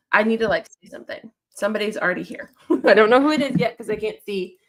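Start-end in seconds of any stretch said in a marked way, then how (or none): 0.7-1.3 whisper. Real, not acted. Aside muttered to herself.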